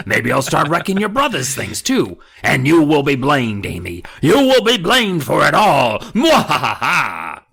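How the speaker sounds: loud, high gloating dummy voice ending in a cackle. Acted. The dummy gloats to Amy that she'll be blamed, loud and high. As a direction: gloat over her and cackle. Dummy threatening, evil laugh.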